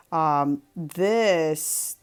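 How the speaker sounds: normal talking voice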